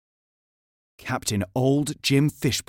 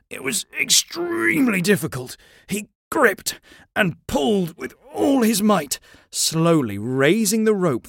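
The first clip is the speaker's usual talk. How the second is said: higher, strained storytelling as if hauling; straining effort; 7 semitones higher than usual. A narrator's reading. Narrator tells of George heaving the rope, straining. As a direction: narrate it as if pulling hard.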